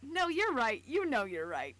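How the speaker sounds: loud, laughing yes-you're-right; laughing concession. Real, not acted. Giving in to the co-reader's correction, loud and laughing.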